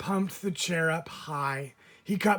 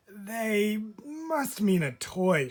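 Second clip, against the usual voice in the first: slow, higher, puzzled mother voice; doubtful mom; 6 semitones higher, much slower than usual. Acted. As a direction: mom unsure, slowly reasoning. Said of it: "she said".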